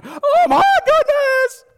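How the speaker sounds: very high and loud, slow, laughing; panicked exclamation. Retold or imitated. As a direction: shriek in sudden alarm. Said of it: "I went".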